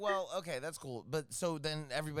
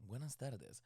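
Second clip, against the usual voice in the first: suave Spanish; 6 semitones lower, 11 dB quieter, slower than usual. Retold or imitated. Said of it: "I'm thinking suave".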